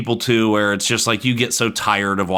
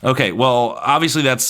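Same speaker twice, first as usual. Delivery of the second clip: ordinary talk, nothing marked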